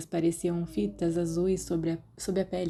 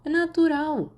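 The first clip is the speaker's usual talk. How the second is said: high, loud offhand reply; Sirius shrugging; 10 semitones higher, 5 dB louder than usual. A narrator's reading. Sirius brushes off Remus's disgust, high and airy. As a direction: toss it off lightly.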